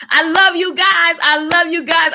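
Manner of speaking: high, drawn-out affection; excited, high-pitched shout-out; gushing excitement. Real, not acted. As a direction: gush it to the fans. Gushing to viewers after singing.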